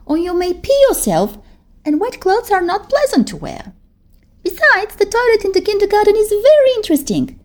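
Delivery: put-on a big girl calming her scared friend; soothing older girl. Gabby advising.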